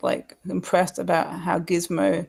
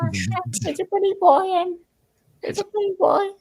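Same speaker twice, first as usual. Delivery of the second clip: much higher squawky parrot voice; high parrot mimic; 13 semitones higher than usual. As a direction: play a talking parrot, pitched way up. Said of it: "imagine a parrot trying to speak".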